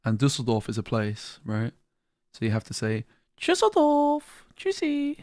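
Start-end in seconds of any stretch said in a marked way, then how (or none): 3.4-5.2 high, tiny "Tschüsseldorf" then "Tschüssi"; cutesy voice. Shown as a demonstration. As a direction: say "Tschüsseldorf" and "Tschüssi" in a sweet baby voice. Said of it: "Like a little cutesy voice".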